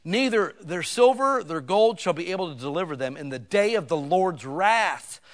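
loud, high preaching of doom; full-force preaching. Acted. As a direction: thunder the scripture at the congregation. Reads scripture at full force.

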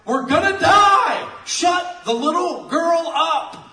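loud, high, drawn-out yelling; mock panicked shouting. Preacher plays people deafened by the little girl, mock panicked shout. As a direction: yell it slowly like a hammed-up panicking crowd.

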